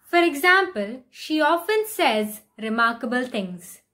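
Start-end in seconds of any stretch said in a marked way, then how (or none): none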